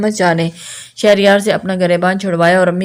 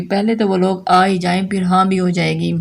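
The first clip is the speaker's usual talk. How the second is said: usual speaking voice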